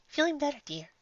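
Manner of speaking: high, gentle motherly mare calling out; a kindly shopkeeper voice, lifted high; sweet baker mare. Acted. Baker calling to guests from the oven, sweet and high.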